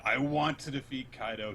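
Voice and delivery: half-hearted retold outcry; retold shout. Momonosuke pleads with Luffy, mild retold shout. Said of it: "he shouts out".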